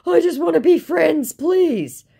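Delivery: mock the scammer begging; imitating a whiny scammer; whiny mock plea. Voicing scammer's pleading.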